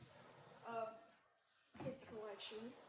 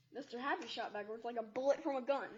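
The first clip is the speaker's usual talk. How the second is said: usual speaking voice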